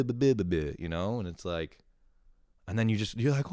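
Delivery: ordinary talk, nothing marked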